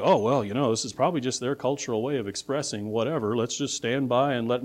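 low, softening shrug; mock resignation. Preacher imagining Paul and Barnabas standing by, mock resignation. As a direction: mumble along as if not wanting trouble. Mimics apostles shrugging off error.

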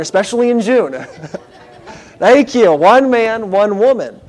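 real chuckle in the voice; amused. Real, not acted. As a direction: tease the crowd, then laugh. Joking with congregation, laughs.

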